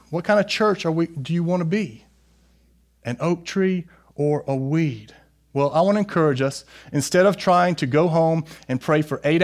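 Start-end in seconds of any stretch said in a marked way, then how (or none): none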